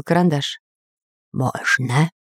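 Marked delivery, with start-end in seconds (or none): 1.3-2.1 low, strange spirit reply; mysterious voice. A narrator's reading. Spirit grants the artist a question, low mysterious voice. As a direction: answer obscurely from beyond, pitched low. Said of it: "ответил непонятный голос".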